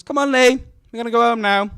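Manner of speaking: put-on loud, high call to a child; mum calling. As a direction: call your kid in to go home. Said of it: "then my mum calls".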